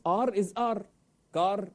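R trilled on the tongue in "R" and "Car"; old-world trilled R, not the American one in "Car"; rolled R. Shown as a demonstration. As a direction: roll the R hard in "R" and "Car". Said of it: "You know, you roll it".

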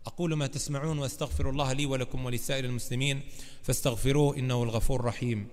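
fast, low-pitched formulaic chant; rapid Arabic recitation. Acted. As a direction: rattle off the Arabic sermon formula. Arabic khutbah closing formula.

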